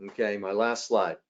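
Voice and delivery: ordinary delivery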